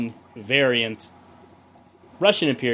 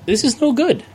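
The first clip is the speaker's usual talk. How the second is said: exasperated; 10 semitones higher, 3 dB louder than usual. Real, not acted. Disgust at the beer.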